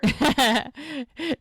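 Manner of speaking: real burst of laughter; spontaneous cackle of amusement; amused laugh. Real, not acted. Laughs at co-host's sister remark.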